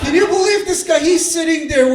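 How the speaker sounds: rally the room against him; incredulous call. Real, not acted. Rallying crowd in disbelief.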